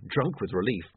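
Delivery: ordinary talk, nothing marked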